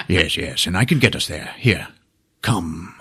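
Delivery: ordinary talk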